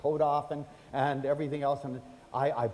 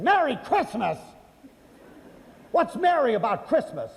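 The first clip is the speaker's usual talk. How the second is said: higher, louder scoffing comeback; scornful retort; 7 semitones higher, 4 dB louder than usual. Retold or imitated. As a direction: throw the greeting back at him with contempt. Bitter shopper retorting.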